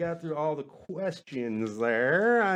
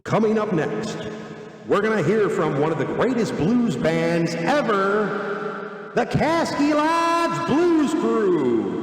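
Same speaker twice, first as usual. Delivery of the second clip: announcer voice; 3 semitones higher, 3 dB louder, slower than usual. Pretend. Introducing his blues band to the viewers, slow booming announcer. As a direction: introduce the band like a radio host.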